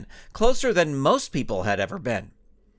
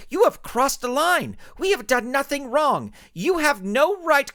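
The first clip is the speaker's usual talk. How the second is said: much higher, louder indignant shriek; indignant plant lady; 12 semitones higher, 3 dB louder than usual. Acted. Plant Lady scolding the superhero, high indignant voice. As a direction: scold her, pitched way up. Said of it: "The plant lady stepped".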